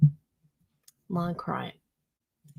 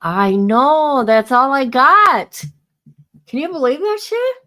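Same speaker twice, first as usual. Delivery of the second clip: excited outburst; 5 semitones higher, 7 dB louder than usual. Real, not acted. Reacting loudly to chat live.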